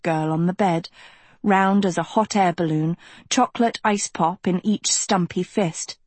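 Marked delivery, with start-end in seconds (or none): none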